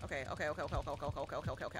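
low, soft, rapid-fire muttering; rattled-off okays. Real, not acted. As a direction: chatter it under your breath in one run. Hurriedly giving in during game.

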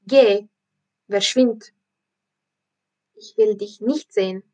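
loud, angry sending-away; hissing at her. Acted. Circus director chasing Pippi out of the ring, loud and angry. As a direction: snap at the girl to get lost. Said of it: "fauchte er".

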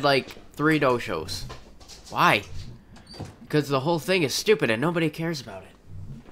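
ordinary delivery

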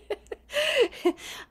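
high, loud burst of laughter; real laugh. Real, not acted. Laughing at own aside to the meditation group, high laughter.

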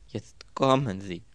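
usual speaking voice

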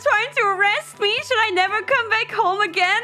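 high, rapid-fire panicked questions; worried. Retold or imitated. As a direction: spiral into hammed-up panic, fast and high. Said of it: "I get more and more worried".

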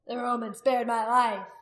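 loud, slow declaration to a crowd; crying out. A narrator's reading. Warrior testifying to all present, crying out. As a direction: call it out for all to hear. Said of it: "Rolf cried out to all".

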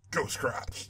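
spooky whispered Ghost Crotch. Shown as a demonstration. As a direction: breathe Ghost Crotch in a hush.

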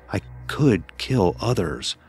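usual speaking voice

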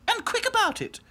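high haughty doll voice; snooty little princess. A narrator's reading. Princess dolls.

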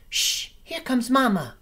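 high little bird hushing the others; hissed warning. Acted. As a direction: shush the nest, Mama is coming. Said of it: "hissed Pip".

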